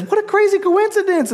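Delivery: high, far put-on voice of a doubter; mock the skeptic in a silly voice; squeaky mocking skeptic; scoffing non-believer. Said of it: "the non-believer says".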